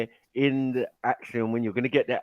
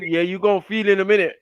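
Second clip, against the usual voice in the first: higher, then louder and fast, ordering him to bed; bossy demand; 5 semitones higher, 4 dB louder, faster than usual. Retold or imitated. Woman ordering her man into the bedroom, mock bossy. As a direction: snap him into line, playfully.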